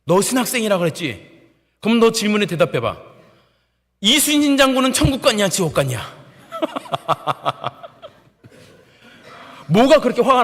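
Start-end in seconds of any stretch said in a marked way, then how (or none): 0.1-6.0 loud, high confrontational challenge; angry interrogation. Retold or imitated. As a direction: corner the recruit with the question. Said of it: "저한테 화를 내면서 이렇게 따지는".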